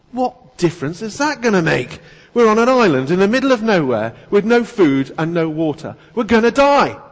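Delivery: exasperated panic. Acted. Shipwrecked man shouting at his calm companion in panic. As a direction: hurl it back at him, frantic. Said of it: "The first man looked at him, dumbfounded".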